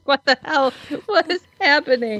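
high, incredulous outcry; mock shock. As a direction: play up the disbelief. Mock reading reaction.

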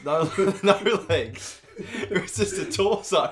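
high, loud, slowed by laughter; laughing. Real, not acted. Teller describes the man to his co-host, cracking up. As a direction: struggle to get the words out through laughs.

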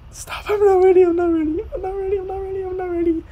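high, tearful pleading; real tears as the concert starts; crying. Real, not acted. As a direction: sob out that you're not ready. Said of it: "I'd be crying".

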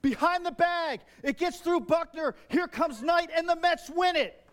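high, loud excited play-by-play; Vin Scully call. Retold or imitated. Preacher recreating the famous call for the congregation, excited and loud. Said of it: "the great Vin Scully, that call".

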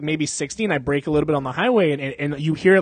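ordinary talk, nothing marked

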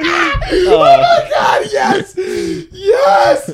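high, cracking, laughing shout of joy; delighted shriek. Real, not acted. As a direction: shriek with triumph through laughter. Friends finally got the sexy voice.

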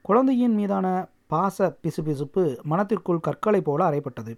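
ordinary delivery

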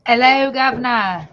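a hammed-up British greeting in a mock British accent. Co-host greeting the host with a joke, in a mock British accent. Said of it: "in a British accent".

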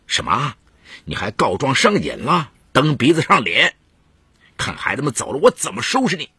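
indignant husband, voice climbing high; irate, acted dressing-down; angry threat. A narrator's reading. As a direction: scold and threaten with mounting emphasis. Husband overhears, threatens his wife.

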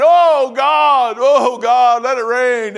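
loud, high mock wail to God; crying out in prayer. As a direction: belt out an exaggerated plea to heaven. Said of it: "So I'm crying".

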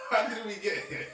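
high, loud laughing question. Real, not acted. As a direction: laugh it out in disbelief.

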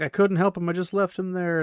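high, lightly voiced lament; mild despair. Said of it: "it's like".

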